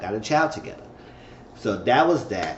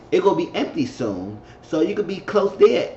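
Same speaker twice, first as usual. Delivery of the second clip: normal talking voice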